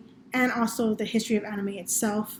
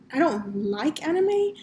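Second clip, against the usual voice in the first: slow, deliberate weight on "like"; stress on like; 3 semitones higher, slower than usual. Retold or imitated. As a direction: draw out "like" to set up a correction. Retold correction of a student.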